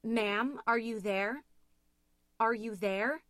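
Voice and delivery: anxious 911 operator. A narrator's reading. Operator calls to the frozen caller, anxious. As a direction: keep calling to a silent caller. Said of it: "asking her the same question over and over again".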